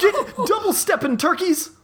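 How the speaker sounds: squeaky, shrill-high tree blob outburst. Acted. Angry tree blob yelling at the intruder, high squeak. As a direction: yelp it like a cartoon monster.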